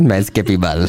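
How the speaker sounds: low, mock Surinamese accent. Teasing a colleague over the catchphrase, mock Surinamese accent. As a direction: imitate a bad Surinamese accent. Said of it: "Waarom werd dat een Surinaams accent?".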